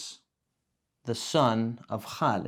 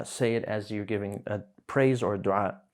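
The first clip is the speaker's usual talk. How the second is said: ordinary talk, nothing marked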